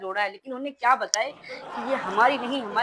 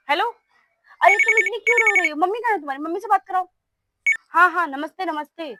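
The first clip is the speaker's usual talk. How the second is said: higher, louder, fussing over the crying bride; hammed-up fuss; 6 semitones higher, 3 dB louder than usual. Mother-in-law calls the bride's mother, with fake fuss. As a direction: lay on the fake concern thick.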